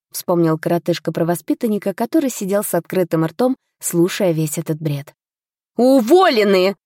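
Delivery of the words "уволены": loud, high, slow pronouncement; magically amplified. A narrator's reading. Lady sacks the tutor with magic, voice amplified. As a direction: fire them with a booming, drawn-out word. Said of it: "Я усилила голос магией".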